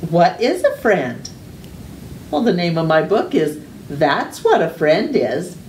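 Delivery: plain reading voice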